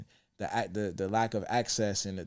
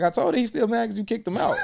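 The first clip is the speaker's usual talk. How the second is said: higher, laughing through dad's words; laughing quote of father; 12 semitones higher, 3 dB louder than usual. Retold or imitated. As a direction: retell dad's line, cracking up. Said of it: "he like".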